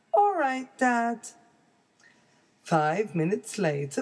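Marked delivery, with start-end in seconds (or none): 0.1-1.2 high little cub voice; a small child bear, cheerful; baby bear voice. Acted.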